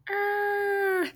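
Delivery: high, mock monster growl; scary growl. Shown as a demonstration. Librarian doing the big scary face again, playful mock growl. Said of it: "Big scary face again".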